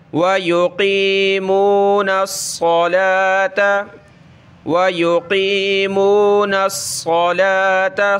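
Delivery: الصَّلَاةَ with a heavy, hissing ص; teacher shows the heavy sad; heavy whistling sad. Shown as a demonstration. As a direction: make the ص in الصَّلَاةَ whistle and thicken. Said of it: "صاد پہ سیٹی کی آواز نکالیں اور موٹا کرنا ہے".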